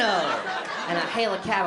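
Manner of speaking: normal talking voice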